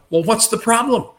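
high, incredulous "what's the problem"; puzzled high question. Retold or imitated. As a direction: ask it as if the answer is obvious. Retells his puzzled reply.

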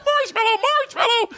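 very high panicked squeal; high desperate plea; panicked safe word. Retold or imitated. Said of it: "And I'm like".

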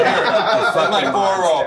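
laughing. Real, not acted. Laughing over food.

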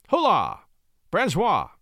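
high summons to a servant; calling out. A narrator's reading. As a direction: call sharply for your man. Marquis calls his servant.

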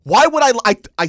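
high, loud, slow shouting; angry yell. Retold or imitated. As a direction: yell it at dad in disbelief. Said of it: "I went".

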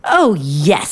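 emphatic, indignant character voice; story-time fuming. Acted. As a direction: confirm it in an acted huff. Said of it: "I'm fuming".

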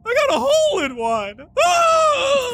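high, crying wail; wailing sob. Acted. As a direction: wail it out between sobs. NPC wails the punchline.

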